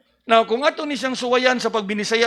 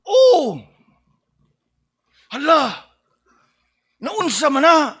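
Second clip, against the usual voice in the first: high loud "Oh! Hala!" as if witnessing a fall; alarmed bystander; startled exclamation; 5 semitones higher, 4 dB louder than usual. Shown as a demonstration. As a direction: cry out then ask what happened. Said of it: "the purpose of that word there".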